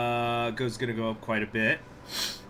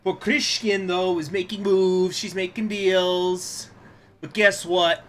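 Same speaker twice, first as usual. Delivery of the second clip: sassy taunt; 6 semitones higher, 11 dB louder, much slower than usual. Pretend. Quotes a meme reference.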